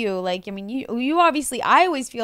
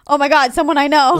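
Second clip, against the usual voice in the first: higher, much louder happy exclaim; loud relieved excitement; 6 semitones higher, 11 dB louder, faster than usual. Retold or imitated. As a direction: blurt it out, thrilled to see a friend. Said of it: "and I was like".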